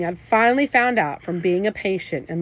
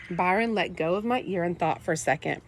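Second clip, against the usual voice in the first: ordinary delivery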